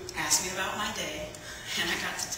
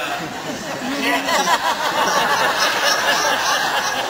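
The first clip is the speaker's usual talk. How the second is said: loud, long burst of real laughter; can't hold back the laugh; big laugh; 7 semitones higher, 8 dB louder than usual. Real, not acted. Laughing to the congregation at his own story.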